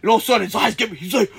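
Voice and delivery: normal talking voice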